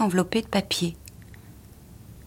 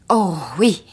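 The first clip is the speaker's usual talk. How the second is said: eager cry, kept in check; mild exclamation; 3 dB louder than usual. A narrator's reading. Wife agreeing to drop her husband at the club, mild exclamation. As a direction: exclaim it with a drawn-out oh. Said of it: "s'écria-t-elle".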